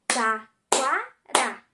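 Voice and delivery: "Taquara" loud and slow, Ta - qua - ra; a pause after each syllable of "Taquara". Shown as a demonstration. Teacher repeats the count, "Taquara" split into Ta, qua, ra. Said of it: "Vamos novamente?".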